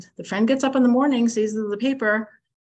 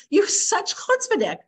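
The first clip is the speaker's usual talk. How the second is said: indignant accusation; 9 semitones higher, 3 dB louder than usual. Retold or imitated. Accusing neighbor of nerve.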